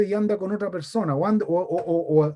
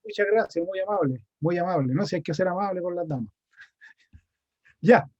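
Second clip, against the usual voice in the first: normal talking voice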